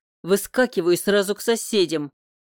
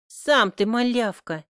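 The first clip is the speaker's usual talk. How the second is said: snapping back; 5 semitones higher, 4 dB louder than usual. A narrator's reading. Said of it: "Огрызается она".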